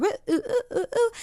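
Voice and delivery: soft, high-pitched little oohs; quick run of high, light "ooh" sounds; playful oohs. Real, not acted. Playful flourish after "breakthrough.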